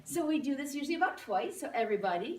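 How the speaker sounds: ordinary talk, nothing marked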